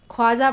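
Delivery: ख्वाजा as 'khaaja', no w sound; silent vav. Shown as a demonstration. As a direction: drop the w after kh in ख्वाजा. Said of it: "वाव को नहीं पढ़ना है". (ख्वाजा में वाव बिना बोले)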